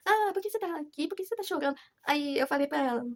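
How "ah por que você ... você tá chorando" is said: imitating the girl. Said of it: "a Fulana tinha perguntado assim".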